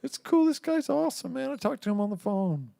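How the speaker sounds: high, breezy falsetto agent; booking agent voice. Retold or imitated. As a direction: chirp it like a carefree agent. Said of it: "And she's like".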